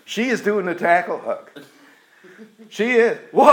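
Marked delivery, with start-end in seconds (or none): none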